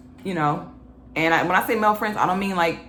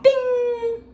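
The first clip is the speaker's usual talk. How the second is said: ding sung high like a bell; 3 dB louder than usual. Pretend. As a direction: ring it out like a lightbulb moment. Light bulb sound.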